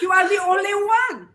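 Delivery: put-on proud father in thick Arabic accent, emotional. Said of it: "in his thick accent".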